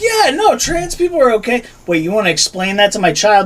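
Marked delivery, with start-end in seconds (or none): 0.0-1.6 high, sing-song mock of a fake-accepting parent. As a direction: sweetly fake acceptance, pitched way up. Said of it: "They're like, they're like".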